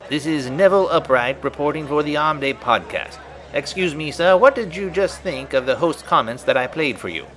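put-on prim old-time field reporter; street reporter voice. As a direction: interview a passerby in a vintage broadcast voice. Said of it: "our man on the street".

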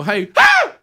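sudden piercing scream after a casual hey; startled scream. Retold or imitated. Wife startled by husband walking in, screaming. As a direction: shriek like she just got jumped. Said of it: "I'll go".

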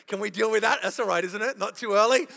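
ordinary talk

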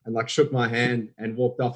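ordinary talk, nothing marked

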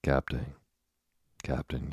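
understated yell, kept within narration; mild narrated yell. A narrator's reading. Bumpkin running to the officer for help, mild yell. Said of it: "yelled the bumpkin".

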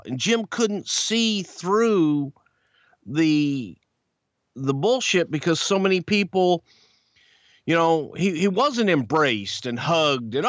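ordinary talk

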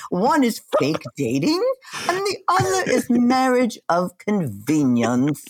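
hammed-up British accent, swooping pitch. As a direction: put on an exaggerated British narrator. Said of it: "Talk in a British accent".

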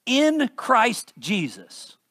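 slow, heavy stress on "in". Shown as a demonstration. Preacher to congregation, leaning slowly on "in". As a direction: lean hard on "in" before Christ Jesus. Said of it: "I'm emphasizing the words I am".

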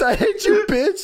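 high laughing screech; mock Vince heckling. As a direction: screech it while cracking up. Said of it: "It's Vince".

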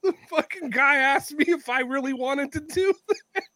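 high, laughing imitation of a griping colleague; amused mock complaint. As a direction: tip into laughter as you quote him. Retelling colleague's complaint, breaks into laughter.

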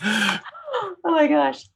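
very slow, breathless laugh. Real, not acted. Guest cracking up at host's skit, laughing. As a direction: let the laugh carry it.

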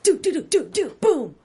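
rapid-fire mouth-made impact noises ending on a boom; vocal sound effects. Retold or imitated. Acting out the quick knockout for a co-host, vocal sound effects. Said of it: "Your sound effects are so much better".

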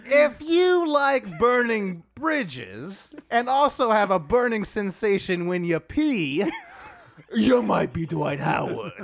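drawn-out comic drawl; Dwight Howard voice. Pretend. A host delivering roast jokes in a mock drawl. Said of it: "can we dial that accent up a little".

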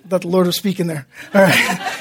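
low, soft chuckling aside; real chuckle under the words; amused aside. Real, not acted. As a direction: laugh it off quietly, quickly. Amused aside after an interruption.

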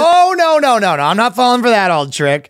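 high, loud opening that settles; mock emphatic refusal. Acting a man scolding his own body, hammed-up refusal. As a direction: wail the first refusal, then dismiss it.

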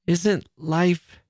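high, halting and unfinished; stammered. A narrator's reading. Sister struggling to explain to her brother, lightly stammered. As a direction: falter upward, searching for the words. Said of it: "she stammered".

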